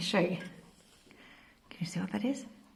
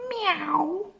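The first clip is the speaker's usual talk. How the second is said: high, rising kitty meow; cat meow; 11 semitones higher than usual. Pretend. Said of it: "think about the sound".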